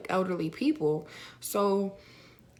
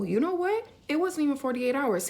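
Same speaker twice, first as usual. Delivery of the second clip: jumps up high, catching her own mistake; a sharp high "wait, no"; sudden correction; 7 semitones higher than usual. Real, not acted. Correcting her story to viewers, high and sudden.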